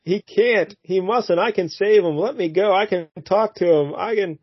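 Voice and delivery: high, pleading mock damsel; hammed-up high desperate pleas; frantic heroine. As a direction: beg to be let go, hammed up. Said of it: "Debbie's like".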